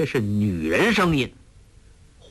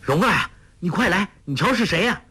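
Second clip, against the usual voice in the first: higher, fast eager call; 7 semitones higher, faster than usual. A narrator's reading. 郭靖 calls 黄蓉 to come see, eager. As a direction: call her over, excited. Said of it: "郭靖叫道".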